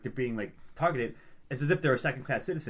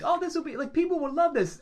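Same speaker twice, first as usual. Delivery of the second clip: much higher, eager and naive; mock naive enthusiasm; 3 dB louder than usual. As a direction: sell the idea brightly. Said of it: "are like".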